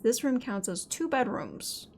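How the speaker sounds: normal talking voice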